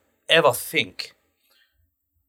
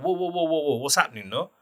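higher-pitched imitated alarm; taken aback; 5 semitones higher, 3 dB quieter than usual. Agency man reacting to an angry caller, taken aback. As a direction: cut in to calm an irate caller.

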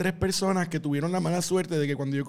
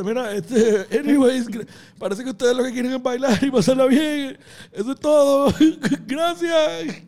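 higher and louder, nervous laughing wrap-up; flustered stage exit; 7 semitones higher, 6 dB louder, slower than usual. Retold or imitated. Comedian cutting his set short after a heckle, loud flustered thanks. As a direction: rush out a mock-cheerful goodbye. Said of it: "ahí mismo dice".

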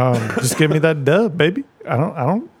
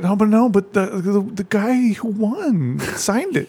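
high mocking voice of a gullible fan; mock buyer; 8 semitones higher than usual. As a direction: ham up a dupe defending the hat. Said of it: "They'll be like".

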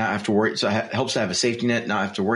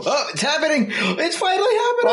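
high-pitched mock squeal of excitement; excited Sarah Palin. Politician watching through binoculars at her window, mock excited squeal. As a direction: squeal it, hammed-up and thrilled. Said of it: "Sarah Palin's looking with the binoculars".

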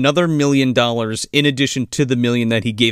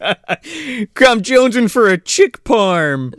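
silly mock boss; 8 semitones higher, 3 dB louder, much slower than usual. Silly rich man voice.

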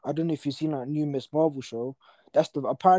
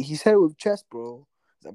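normal talking voice

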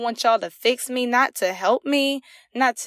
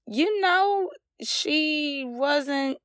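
tearful break; 4 semitones higher, 4 dB quieter, much slower than usual. Real, not acted. Retelling her hospital intake, voice catching with real tears. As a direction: voice catches and thins, near tears.

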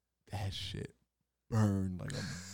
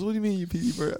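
mom's voice; 10 semitones higher, 6 dB louder, faster than usual. Retold or imitated. Mom voiced, high and loud.

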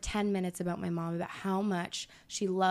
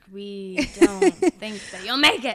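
laughing, louder mock doubt; mocking the family; 7 dB louder than usual.

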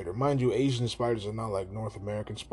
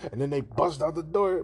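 normal talking voice

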